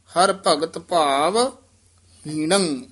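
ਹਰ ਭਗਤ ਭਾਵ said slowly, then a pause, then ਹੀਣੰ; pause before ਹੀਣੰ. Shown as a demonstration. Teacher showing students the pause before ਹੀਣੰ. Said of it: "ਇੱਥੇ ਵਿਸਰਾਮ ਹੈ".

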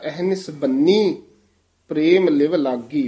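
ਬੰਨੀ with a firm, held double n; tight doubled consonant. Shown as a demonstration. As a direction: press hard on the nn of ਬੰਨੀ. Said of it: "ਪਾਠ ਕੱਸ ਕੇ ਕਰਾਇਆ ਜਾਂਦਾ ਹੈ". (ਬੰਨੀ ਕੱਸ ਕੇ ਬੋਲੋ)

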